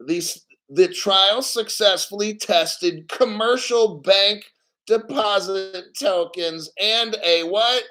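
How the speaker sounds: ordinary delivery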